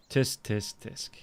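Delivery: mock disapproval.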